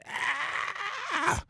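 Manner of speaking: very high thin mock scream; squealed fake cry of agony. Acting out Darby's kneecaps snapping.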